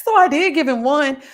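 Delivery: loud, high laugh; real laughter. Real, not acted. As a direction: crack up then confess. Laughs at own story.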